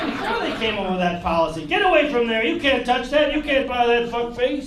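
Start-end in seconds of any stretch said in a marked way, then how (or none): 1.6-4.7 loud, raised-pitch hassling; scolding store clerk. Acted. Store clerk hassling a customer before the new policy, loud scolding. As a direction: berate a customer away from the goods.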